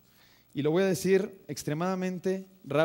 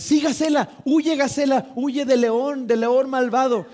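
much higher and louder excited cheering; root for the gazelle like a worked-up dad; 7 semitones higher, 8 dB louder, slower than usual. Retold or imitated. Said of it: "está mi papá y dice".

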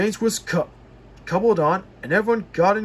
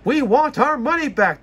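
high, loud angry demand; angry passengers shouting at the ticket office; shouted; 8 semitones higher, 5 dB louder than usual. A narrator's reading. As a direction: crowd yelling at the ticket office. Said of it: "they shouted".

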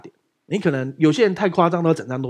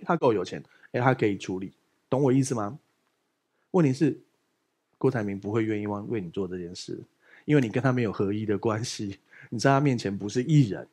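normal talking voice

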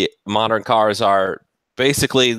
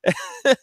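laugh. Real, not acted. Laughing after the Dodge impression.

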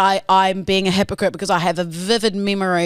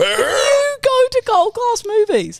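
teasing accusation; 4 dB louder, slower than usual. Real, not acted.

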